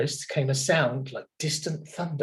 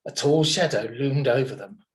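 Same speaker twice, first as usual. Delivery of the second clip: ordinary delivery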